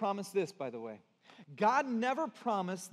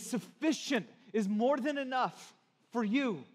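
higher-pitched, driving preacher delivery; building, urgent pulpit voice; impassioned preaching; 5 semitones higher than usual. Real, not acted. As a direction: press each phrase home to the congregation.

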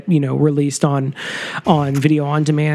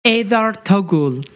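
put-on name read in a made-up Stargate alien accent; playful fake Stargate accent; mock Stargate accent. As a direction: say it like invented Stargate language. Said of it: "These are all in, uh, showery, uh, accents".

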